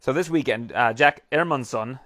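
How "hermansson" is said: "Hermansson" with a silent H, like "Airmansson"; Swedish-style "Hermansson", opening on "air"; Swedish pronunciation. Shown as a demonstration. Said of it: "that's how you pronounce it".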